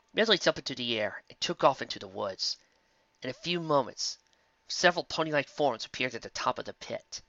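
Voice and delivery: ordinary delivery, nothing marked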